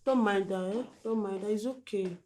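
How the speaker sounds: soft, gentle comfort; soothing. Acted. Friend comforting crying Juju, very soft and gentle. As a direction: hush her tears softly.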